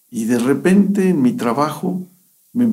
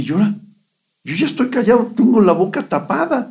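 frightened; 9 semitones higher, faster than usual. Retold or imitated. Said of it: "me asusté".

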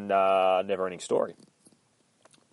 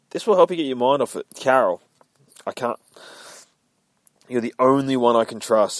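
Carol insisting; 5 semitones higher, 7 dB louder than usual. Acted. Carol pressing Finch.

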